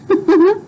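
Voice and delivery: short high burst of laughter; real laugh. Real, not acted. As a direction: laugh at the scene. Reader laughs at the scene.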